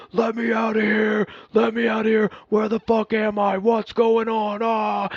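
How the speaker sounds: high, loud panicked shouting; panicked yelling. Retold or imitated. Roommate waking up confused, yelling to be let out, panicked. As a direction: yell like you woke up trapped somewhere strange. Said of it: "he's like, kicking the fucking door like he's breaching it".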